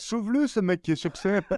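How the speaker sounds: high mock rescue cry through laughter; laughing plea. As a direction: plead jokingly while cracking up. Joins the joke, laughing.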